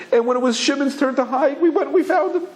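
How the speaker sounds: mock child's wail, very high-pitched; crying little boy. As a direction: carry on like a tearful little boy. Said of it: "carrying on".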